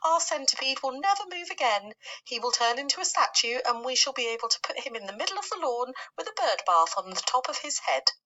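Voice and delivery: a doom-saying worm predicting the worst; lightly voiced gloomy earthworm.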